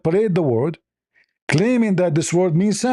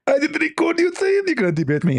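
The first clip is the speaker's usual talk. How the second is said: much higher, squeezed mocking preacher voice; Zakir Naik impression; 13 semitones higher than usual. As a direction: squeeze it high and smug. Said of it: "I am Doctor Zakir Naik".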